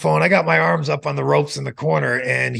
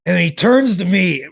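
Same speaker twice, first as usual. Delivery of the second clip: ordinary talk, nothing marked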